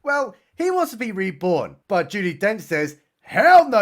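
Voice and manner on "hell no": loud, high mock outburst; emphatic refusal. Judi Dench refuses Macavity, mock-outraged and loud. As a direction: burst out with a hammed-up refusal.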